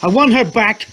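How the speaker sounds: high, loud anguished scream. Acted. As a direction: scream it from the gut. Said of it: "she screamed".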